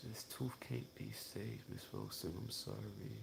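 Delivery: a hint of a sorry dentist, hushed; hushed apology. Dentist apologizing to Miss Wilson, hushed and sorry. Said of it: "She says".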